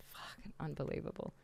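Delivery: quick whispered curse; fast whispered mock outrage. Real, not acted. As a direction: hiss it fast under the breath. Mock indignation aside.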